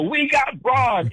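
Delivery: high, drawn-out mock yell; imitated yelling. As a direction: mimic a fan calling out of a car window. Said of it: "yelling out the window".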